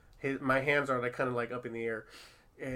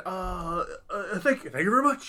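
hesitant mock Nick Cage thanks; a hint of Nicolas Cage, stumbling; lightly voiced Nick Cage thank you; Nicolas Cage impression; 4 semitones higher, 4 dB louder than usual. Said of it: "he's being Nicolas Cage as Nicolas Cage can be".

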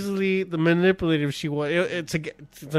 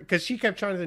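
normal talking voice

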